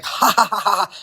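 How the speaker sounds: a quick fake villain laugh; mock maniacal laugh. Mocking Rollins' Joker gimmick, light high cackle. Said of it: "comes out laughing".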